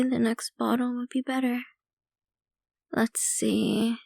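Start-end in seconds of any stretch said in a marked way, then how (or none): 2.9-4.0 breathy whispered aside; hushed whisper while browsing. Acted. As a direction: murmur it to yourself under the breath. Vampire murmurs while picking bottles.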